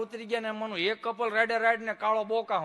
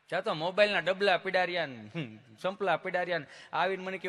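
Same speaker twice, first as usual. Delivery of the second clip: usual speaking voice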